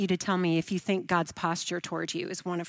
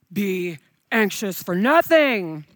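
slow and louder, a cranky stern scripture reader; stern scolding reading; 4 dB louder, slower than usual. Shown as a demonstration. Preacher mocking a cranky Bible app reading scripture sternly. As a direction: read it like a frustrated, stern narrator. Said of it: "That's not his tone".

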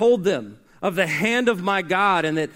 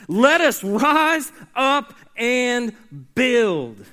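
slow, lifted and loud, every word stretched; slow proclaimed cry; 4 semitones higher, 4 dB louder, much slower than usual. Acted. Preacher acting out the people's cry, slow and loud. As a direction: proclaim it like a rallying call.

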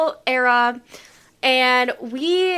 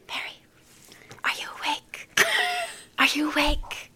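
whispering; 3 semitones higher, 6 dB quieter than usual. Retold or imitated. Whispering at night in bed.

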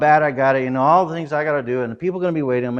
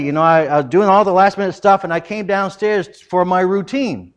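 usual speaking voice

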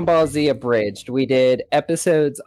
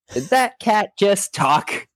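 higher and slow; mock question; 7 semitones higher, slower than usual. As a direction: draw it out slowly, pointing out the absurd. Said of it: "it's like".

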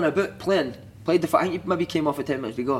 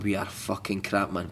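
dejected; 7 semitones lower, 8 dB quieter, slower than usual. Retold or imitated. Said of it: "We were like".

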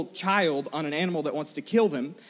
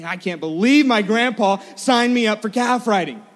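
loud, raised-pitch mock outrage; venting; 4 semitones higher, 7 dB louder than usual. Upset boy complains to his friend about grandpa, venting. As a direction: vent indignantly, reenacting the complaint. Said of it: "I was gonna vent to him".

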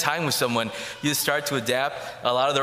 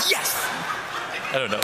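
a light, short burst in the priest's manner; Father Matthias impression. Lightly voiced priest for the listeners, a brief mock exclamation. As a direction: toss off one word as the priest would. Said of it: "All right, here goes".

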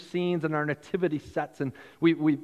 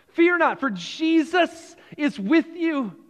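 "Fear not" called out louder and higher, then slower on "for Jesus is with you"; ringing proclamation; 8 semitones higher, 3 dB louder than usual. Shown as a demonstration. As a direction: proclaim it to the whole congregation.

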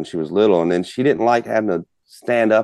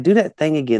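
high-pitched imitated kid request; little girl; 8 semitones higher than usual. Daughter asking again for the cluck, child's plea. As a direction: mimic a child's urgent ask. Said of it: "And she's like".

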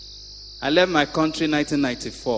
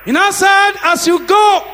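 higher, loud preaching cadence; 14 semitones higher, 9 dB louder than usual. Acted. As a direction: preach it out to the congregation. Preacher at full force.

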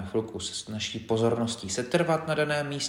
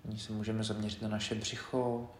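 softer, measured body-scan guidance with long pauses; quiet, unhurried meditation leader; guided meditation; 9 dB quieter than usual. Acted. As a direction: guide them gently through the body, leaving space between phrases.

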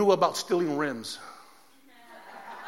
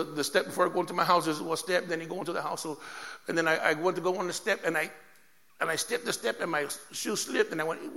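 ordinary delivery

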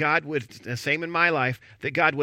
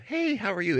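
friendly greeting; 6 semitones higher than usual. Retold or imitated. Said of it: "it wasn't like a".

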